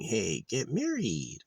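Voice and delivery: high, drawn-out mock suggestion; mock invitation. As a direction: pitch it up and stretch it like a silly offer. Mock casual invitation to marry.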